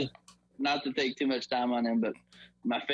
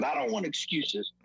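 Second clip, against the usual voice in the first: firm, slightly raised pitch; retold coach's rebuff; 4 semitones higher than usual. Coach answers player's film excuse, contained firm rebuff. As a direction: cut off the excuse without yelling.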